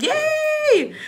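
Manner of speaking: a high squeal of joy; excited yay. Real, not acted. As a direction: cheer it out high. Excited cheer over order.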